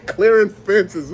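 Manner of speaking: high wheezing laughter around the words; laughing hard. Real, not acted. Telling friends about gators clearing fences, laughing hard. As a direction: crack up helplessly at the memory.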